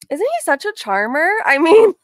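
loud, high mock admiration; sarcastic praise. Real, not acted. Host mocking the man's insulting voice note, loudly sarcastic. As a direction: raise pitch and volume in pointed sarcasm.

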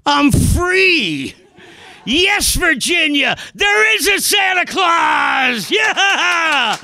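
high, drawn-out ecstatic proclamation; jubilant shouting. Acted. Acted joyful climax, shouting to everybody.

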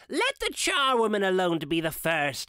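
a lightly voiced charwoman calling out; charwoman crying out. A narrator's reading. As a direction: call it out like a bold old char. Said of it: "she cried".

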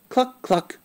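high clucking hen call; a high, hen-like cluck for a child. Acted. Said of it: "It says".

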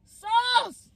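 very high shouted wail; high-pitched cry. Real, not acted. Upset eater crying out over taco sauce, wailing high.

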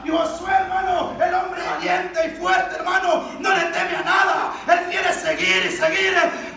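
high, loud, driving sermon delivery; full-force preaching. Real, not acted. Preacher extols valiant Joshua to the church, full-force preaching. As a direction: preach it hard, phrase after phrase.

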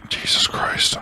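breathy aside under the breath; whispered. Real, not acted. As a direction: whisper it in disbelief. Rebuffed by trainer, muttered.

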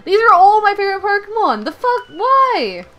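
high, loud, fast complaint; exasperated yelling; frustrated outcry. Real, not acted. Outrage at the game.

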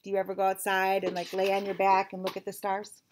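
usual speaking voice

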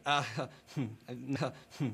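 soft, embarrassed giggle under the breath; nervous laugh. Acted. German-accented interviewer laughs nervously at his own slip. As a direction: laugh it off awkwardly and quietly. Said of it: "I'm nervous".

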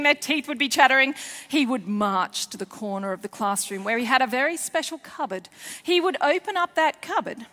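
usual speaking voice

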